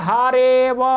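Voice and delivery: हरे व drawn out, no अ between; silent avagraha. Shown as a demonstration. Teacher showing students how to say it, silent avagraha. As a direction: say हरे व slowly as one joined word. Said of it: "इसको बोलना है".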